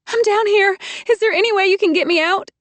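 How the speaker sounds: high, loud urgent plea; pleading call. A narrator's reading. Trapped woman pleading with the man above, high and loud. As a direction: beg to be pulled out.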